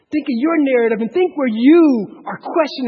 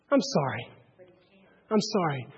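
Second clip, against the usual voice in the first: normal talking voice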